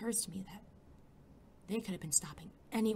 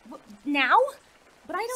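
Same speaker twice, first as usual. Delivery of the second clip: startled squeak; 11 semitones higher, 10 dB louder than usual. Acted. Startled sidekick protests going in.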